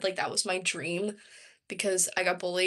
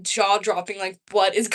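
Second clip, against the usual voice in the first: usual speaking voice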